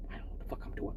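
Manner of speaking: unvoiced aside under the breath; whispered confession to the audience. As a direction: let it slip out as a secret whisper.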